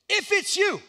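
high, loud call across the water. Acted. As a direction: shout it into the darkness. Peter calls out into the dark.

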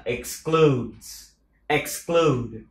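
very slow exclude, rising at the end; slow drill word. Shown as a demonstration. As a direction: draw out exclude. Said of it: "Exclude ha".

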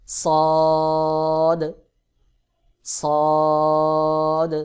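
সদ as a full, long-held Arabic saad; letter demo. Shown as a demonstration. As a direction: stretch সদ loudly on one level note. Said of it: "সদ উচ্চারণ হয়". (জোরে লম্বা টানে সদ উচ্চারণ)

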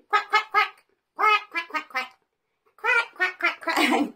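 playful quack quack like a duck. Shown as a demonstration. Teacher quacking like a duck for children. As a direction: quack it for the toddlers. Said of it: "Can you quack like a duck?".

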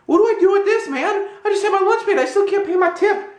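very high, loud whine of protest; exasperated. Retold or imitated. Left holding a coin for the tip, exasperated. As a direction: wail it in mock exasperation.

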